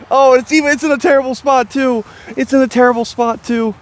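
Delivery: high, agitated complaint; dismayed. Real, not acted. Complaining to camera about his disc's spot, dismayed. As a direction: lament the bad lie. Bemoaning lie after miss.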